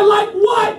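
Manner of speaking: high, sharp demand; imitated irate sergeant. Sergeant pushing trainee to finish, mock outraged demand. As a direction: press him like an affronted sergeant.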